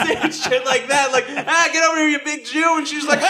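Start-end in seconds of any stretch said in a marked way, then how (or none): 1.3-2.8 high, eager calling over; hamming up a hug demand; laughing mock call; pushy invitation. Pushing a hug on her.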